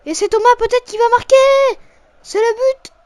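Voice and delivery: high, loud excited commentary; excited goal call. Real, not acted. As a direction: call the late goal with a burst. Last-minute goal called.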